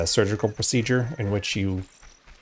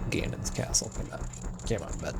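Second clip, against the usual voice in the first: whispered; 11 dB quieter than usual. Real, not acted. Repeats name unvoiced.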